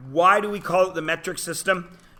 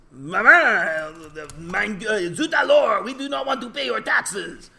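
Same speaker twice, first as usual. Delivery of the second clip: hammed-up French revolutionary, much higher; bad French accent; 6 semitones higher, 4 dB louder, slower than usual. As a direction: play a mock-outraged peasant in a bad French accent. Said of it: "in a bad French accent".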